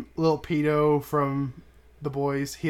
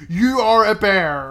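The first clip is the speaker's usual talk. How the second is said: blunt telling; 5 semitones higher, 6 dB louder, slower than usual. Retold or imitated. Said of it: "brother just fucking tells him".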